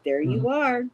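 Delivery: delighted discovery. Retold or imitated. Recalling finding great-grandma in the family line, delighted discovery. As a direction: call it out high on finding someone. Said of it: "And I'm like".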